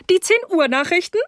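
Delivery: high, put-on hostess voice; joking hostess. A narrator's reading. Mrs Rose joking to her guests, high hostess voice. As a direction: pipe up with a high, teasing suggestion.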